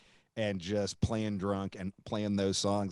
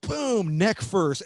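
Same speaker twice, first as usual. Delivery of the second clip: louder, higher boom; mock smash; 7 semitones higher, 6 dB louder, much slower than usual. Smashing the guitar on stage, punchy mock boom. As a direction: slam it out like the guitar hitting the stage. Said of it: "and he goes".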